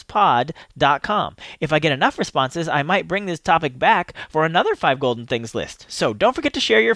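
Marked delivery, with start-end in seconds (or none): 0.0-1.4 pod dot com said slow and clear; slow, spelled out. Shown as a demonstration. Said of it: "spelling out the web address".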